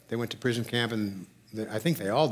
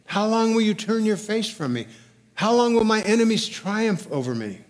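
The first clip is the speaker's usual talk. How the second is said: higher, pleading psalm reading; desperate plea; 6 semitones higher than usual. Retold or imitated. Preacher reads a psalm of desperation, desperate plea. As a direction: cry out in measured desperation. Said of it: "prayers of desperation".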